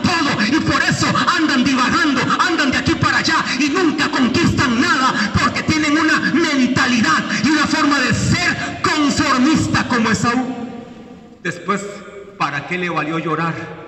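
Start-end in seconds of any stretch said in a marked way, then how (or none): 0.0-10.5 high, loud preacher's cry over music; fiery preaching. Acted. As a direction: preach it hard, pushing every line. Preaching at full force.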